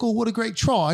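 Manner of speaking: high-pitched imitated British accent. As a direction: ask as a chatty British stranger. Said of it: "like, this British accent".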